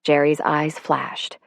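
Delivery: usual speaking voice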